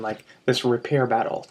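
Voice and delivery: ordinary talk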